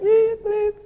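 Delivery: high, loud electronic bleeping; mock radar bleeps. Joking about scanning the coffee shop, high mock radar bleeps. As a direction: beep like a heat-seeking missile locking on. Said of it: "turned on my heat-seeking missile mechanism".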